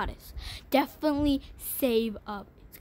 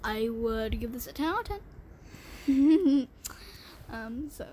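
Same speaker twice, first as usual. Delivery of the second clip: ordinary talk, nothing marked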